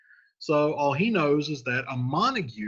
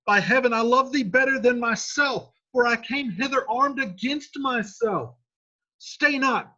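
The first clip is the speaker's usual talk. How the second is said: much higher and louder, pleading; desperate Romeo; 12 semitones higher, 5 dB louder than usual. Acted. Romeo warning Paris off, desperate loud plea. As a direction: beg him to get away. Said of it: "And Romeo says".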